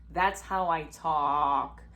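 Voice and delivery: slow, drawn-out deep voice; deep and deliberate, dragging the words. Shown as a demonstration. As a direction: show off your low natural voice, stretched out. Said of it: "this deep-ass voice".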